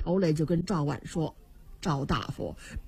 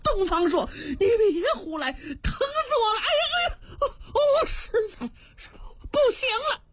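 high, quick, pained wail as if hanging and choking; panicked wailing; 11 semitones higher than usual. Acted. Hanging official wails to Dongfang Shuo in pain, high and frantic. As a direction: cry out that it hurts and he can't hold on. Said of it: "王臧是真急了".

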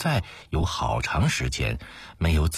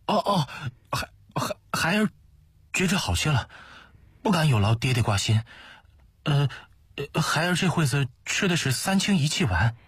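high, stammering voice of a timid son; deferential son; 11 semitones higher, faster than usual. A narrator's reading. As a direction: stumble respectfully through the answer, pitched far up. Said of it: "恭恭敬敬地回答".

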